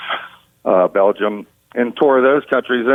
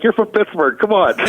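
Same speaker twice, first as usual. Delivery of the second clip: a hint of a chiding mom, pitched higher; teasing mother; 10 semitones higher than usual. Mother ribbing her son on the phone, lightly mock-scolding. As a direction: tease him like an exasperated mother. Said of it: "my mother's like".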